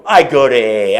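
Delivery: sudden loud protest; loud blurt. Retold or imitated. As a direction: blurt it out defensively. Blurting answer to nurse.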